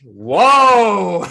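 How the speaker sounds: high, loud shocked whoa; a jolt of disbelief at what she said; stunned whoa. Retold or imitated. Reacting to the old woman's remark, loud shocked whoa.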